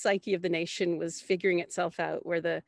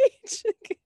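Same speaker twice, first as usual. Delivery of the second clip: a short high burst of laughter; 4 dB louder than usual. Real, not acted. Laughing at the idea of staging it. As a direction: laugh out at your own shock. Laughs at her own shock.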